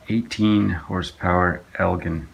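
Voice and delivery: normal talking voice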